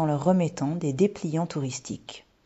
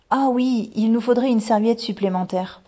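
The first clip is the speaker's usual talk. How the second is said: put-on high, loud child's voice; little girl remembering; 7 semitones higher, 6 dB louder than usual. A narrator's reading. Girl asks boy for a towel, piping up. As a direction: pipe up as if just remembering.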